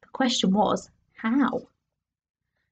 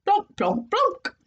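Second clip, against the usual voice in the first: piano plonks; 10 semitones higher, slower than usual. A narrator's reading. Piano keys struck sound effect.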